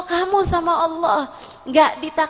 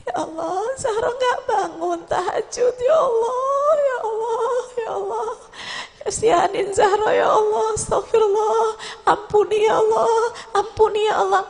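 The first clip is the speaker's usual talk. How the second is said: sobbing prayer; 6 semitones higher than usual. Retold or imitated. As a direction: voice breaking into tearful cries, rising high. Zahro's weeping prayer continues. (doa sambil menangis tersedu-sedu)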